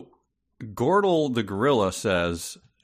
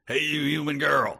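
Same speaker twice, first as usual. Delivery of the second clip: lightly voiced brash gorilla, a bit higher; a hint of a swaggering gorilla; Gordle's voice; 6 semitones higher than usual. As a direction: toss it off like a cocky gorilla. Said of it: "That's my Gordle".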